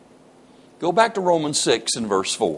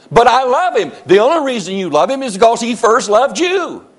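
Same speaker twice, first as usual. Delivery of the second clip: high, insistent pulpit argument; full-force preaching; 9 semitones higher, 5 dB louder than usual. Real, not acted. Preacher telling listeners they cannot obligate God, full-force preaching. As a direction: drive the point home at high pitch.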